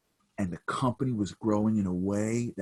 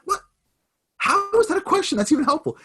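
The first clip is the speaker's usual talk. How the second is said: much higher and louder, fed up; exasperated outburst; 9 dB louder than usual. Retold or imitated. Panicked CEO snapping at the stranger, exasperated. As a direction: let it burst out in disbelief. Son frantic at odd questions.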